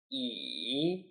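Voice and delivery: E dipping low then rising; third tone e. Shown as a demonstration. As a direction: let E dip down and come back up. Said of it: "Let's read them now".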